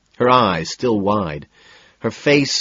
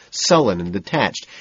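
plain reading voice

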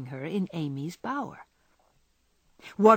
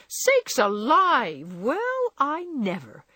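slow, high, drawn-out amazed exclamation; great astonishment; 7 semitones higher, 4 dB louder, much slower than usual. A narrator's reading. Hannah hearing of Jo's doings, greatly astonished. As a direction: draw it out in swooping, high-pitched amazement. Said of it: "in great astonishment".

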